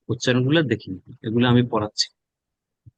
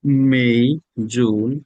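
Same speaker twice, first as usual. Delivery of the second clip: ordinary delivery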